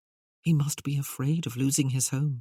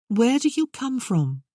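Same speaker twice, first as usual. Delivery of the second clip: high duchess voice; 8 semitones higher, 4 dB louder than usual. Acted. Duchess questions Merlin, voiced high.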